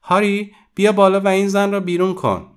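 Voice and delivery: high, loud yell calling down the stairs; shouting. A narrator's reading. Young woman shouts for her husband to come up and throw the visitor out, yelling. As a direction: bellow for Harry to throw her out. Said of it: "فریاد زنان گفت".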